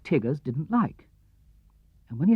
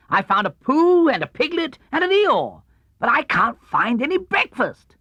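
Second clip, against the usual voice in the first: high, loud bouncy voice; bouncy Tigger; 10 semitones higher, 4 dB louder than usual. Acted. Tigger telling Christopher Robin he's hungry, high and bouncy. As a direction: bounce through the list.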